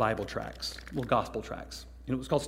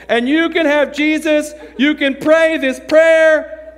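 high, drawn-out mock recitation at full volume; oblivious loud reading; 13 semitones higher, 11 dB louder, slower than usual. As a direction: belt out the prayer slowly, unaware of the volume. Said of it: "didn't understand how loud he was talking".

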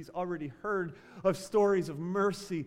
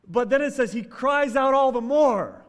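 louder, higher sermon climax; preacher booming the outcry; loud preaching; 5 semitones higher, 10 dB louder than usual. Acted. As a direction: raise it up, pressing hard. Preacher rising to the cry.

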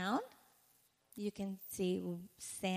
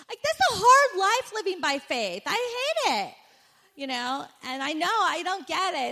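high, loud whining; whiny complaint; 9 semitones higher, 11 dB louder than usual. Retold or imitated. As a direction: whine like you're fed up.

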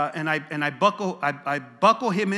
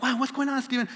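surprised question; 6 semitones higher, faster than usual. Retold or imitated. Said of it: "I'm like".

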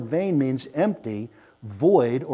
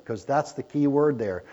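normal talking voice

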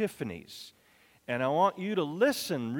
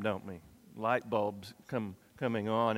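ordinary delivery

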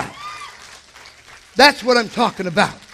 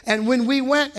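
usual speaking voice

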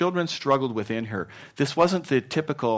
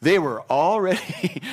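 ordinary delivery

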